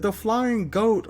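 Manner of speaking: high, drawn-out imitation of a wrestling commentator; mock commentator call; commentator's call. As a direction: stretch out the nickname like a ringside announcer. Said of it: "and then they said".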